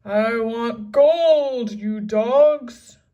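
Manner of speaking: loud, slow greedy roar; angry pirate bellow. A narrator's reading. Captain demanding gold, slow loud bellow. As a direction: bellow at the crew. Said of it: "He spit on the sand".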